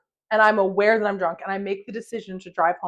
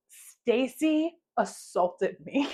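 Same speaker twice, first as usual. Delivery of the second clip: very slow, drawn-out accusation breaking into laughter; mock-solemn writing-out that dissolves into giggles; laughing accusation; 2 semitones higher, 4 dB quieter, much slower than usual. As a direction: spell it out slowly, then crack up. Laughing at own written accusation.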